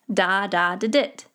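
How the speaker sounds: "da-da-da-dit" as one word, "DAH-DAH-di-dit"; long stressed first two "da", short light "da-dit" ending. Shown as a demonstration. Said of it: "Always put your emphasis on das".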